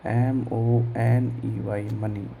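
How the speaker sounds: letter by letter, slow; slow spelling for students; spelled out slowly. Shown as a demonstration. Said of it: "एम का उच्चारण मैंने क्या किया".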